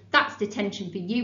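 ordinary delivery, nothing marked

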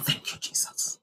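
breathless whisper of relief; whispered thanks. Retold or imitated. Preacher reliving her relief, whispered thanks. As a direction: whisper it like a prayer.

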